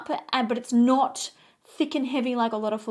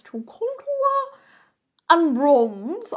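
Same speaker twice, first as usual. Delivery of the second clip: To contour sung up high and drawn out, and bronze punched; playful high singsong title; singsong announcement; 6 semitones higher, 6 dB louder, much slower than usual. Shown as a demonstration. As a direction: announce it like a game show. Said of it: "Okay, to contour and bronze".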